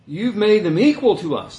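high, loud, indignant complaint; prideful accusation. Retold or imitated. Grumbling laborer accusing the master, high and indignant. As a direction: throw the accusation back at him. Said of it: "That's pride coming in".

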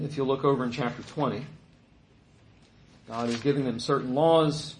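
normal talking voice

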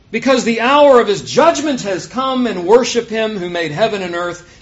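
loud proclamation, easing toward the end. A narrator's reading. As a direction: declare it loudly, then settle. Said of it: "he said with a loud voice".

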